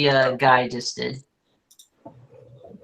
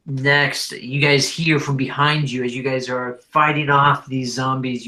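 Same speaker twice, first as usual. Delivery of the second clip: ordinary talk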